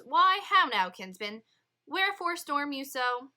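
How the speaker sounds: loud, high startled challenge; Juliet's dad. Acted. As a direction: demand what the fuss is, loudly. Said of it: "and he says".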